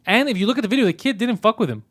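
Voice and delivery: normal talking voice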